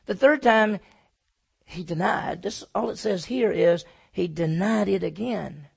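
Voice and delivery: normal talking voice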